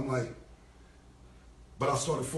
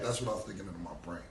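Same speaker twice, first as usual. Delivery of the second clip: usual speaking voice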